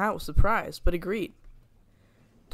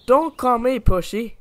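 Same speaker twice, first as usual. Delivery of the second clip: a higher, louder, slowish retort; snapped back; 5 semitones higher, 8 dB louder than usual. A narrator's reading. Douglas snapping back at Donald. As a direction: snap back at your brother. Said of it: "Douglas snapped back".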